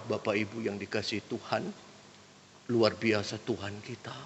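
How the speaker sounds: soft, low, subdued sermon voice; sleep-inducing preacher. Acted. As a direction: preach quietly enough to lull them to sleep. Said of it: "tidur semua jemaatnya". (khotbah pelan dan rendah, bikin mengantuk)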